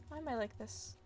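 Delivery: normal talking voice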